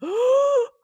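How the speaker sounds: loud, high-pitched mock gasp; shocked gasp. Viewer caught by a jump scare, loud acted gasp. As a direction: suck in air sharply, as if startled. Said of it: "And you're just like".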